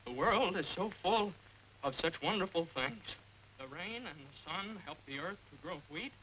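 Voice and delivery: sing-song poem recital. Acted. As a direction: recite it like a sweet verse. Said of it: "I want to share a little poem".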